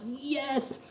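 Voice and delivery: high, drawn-out elated cry; retold ecstatic fan cheer; screaming in excitement. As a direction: cheer it in high-pitched triumph. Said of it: "screaming at the top of my lungs".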